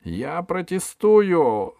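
высокий, громкий, протяжный протест епископа; возмущайся, растягивая слова; злой протест. A narrator's reading. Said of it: "зашипел епископ". (протяжно и возмущённо)

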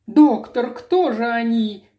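a touch of crying, acted; tearful question. A narrator's reading. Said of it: "плача спросила Мэри".

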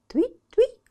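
very high loud chirping; bird chirp. Acted. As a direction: chirp твить like a swallow. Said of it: "крикнула в последний раз ласточка".

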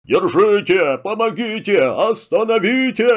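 high, panicked call for help; the lord cries for help as the shoes drag him away, high; panicked wail. Acted. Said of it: "заголосил".

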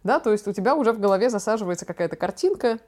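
normal talking voice

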